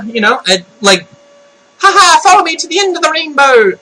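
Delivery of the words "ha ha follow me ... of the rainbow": high, fast, hammed-up Irish; sing-song cartoon Irish accent, pitched up; mock Irish voice. Vlogger misquoting a rainbow catchphrase, mock Irish. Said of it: "I can't do the Irish voice".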